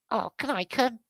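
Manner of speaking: squeaky high little cub. Pretend. Baby bear begging to tag along, high squeaky voice. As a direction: pitch it way up like a small cub. Voicing cub, much higher.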